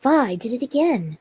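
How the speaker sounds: usual speaking voice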